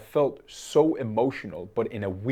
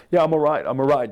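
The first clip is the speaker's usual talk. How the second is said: brushing people off; 5 semitones higher, 9 dB louder than usual. Retold or imitated. Brushing off worried passers-by.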